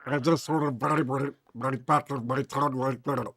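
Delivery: showing off the gurgling underwater voice. Shown as a demonstration. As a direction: talk through a throat full of gargle. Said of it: "I just talk like this".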